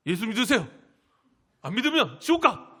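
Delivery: loud mock yell, rising in pitch; irritated evangelizing. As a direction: shout it crossly at passers-by, hammed-up. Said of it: "막 짜증내면서 눈을 막 짜증을". (짜증 섞어 윽박지르는 전도 흉내)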